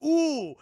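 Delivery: high, loud cry of impact; acted sudden hurt; mock pained "ooh". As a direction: let out a hammed-up "ooh" as if hit. Imitates being hit by block.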